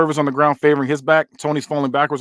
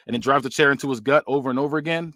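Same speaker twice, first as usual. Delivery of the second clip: usual speaking voice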